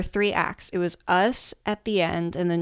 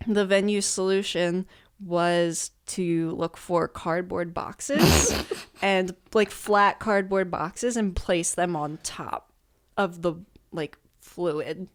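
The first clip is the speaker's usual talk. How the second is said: ordinary talk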